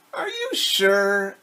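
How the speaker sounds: slow, drawn-out goose voice; slow doubtful goose. Acted. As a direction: stretch the doubt out lazily.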